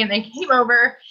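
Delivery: normal talking voice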